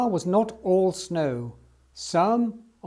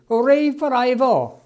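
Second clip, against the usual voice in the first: much higher, louder cheer; crowd shouting; 7 semitones higher, 7 dB louder than usual. Acted. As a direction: cheer the hero home. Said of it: "they shouted".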